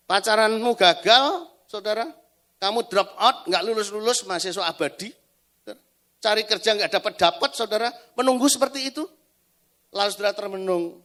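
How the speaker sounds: loud, pressing sermon voice; fiery preaching. Acted. Preacher challenges the congregation, loud and urgent. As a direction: drive the warning home to the church.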